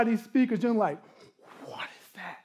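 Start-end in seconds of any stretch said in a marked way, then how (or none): 1.7-2.4 hushed, voiceless whisper of wonder; breathy, much softer reaction; awed whisper. Retold or imitated. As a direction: whisper it as if stunned by the sound.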